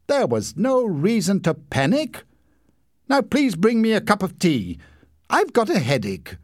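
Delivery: high, lofty self-satisfied airs; man in bed after the fire demanding tea; pompous. A narrator's reading. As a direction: lord it over everyone.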